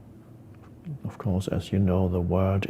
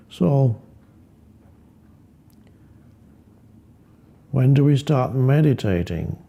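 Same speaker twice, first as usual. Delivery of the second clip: acted frustrated listener, higher and louder; 4 semitones higher, 4 dB louder than usual. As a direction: voice an exasperated seeker's pointed question. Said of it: "may be getting quite frustrated and saying".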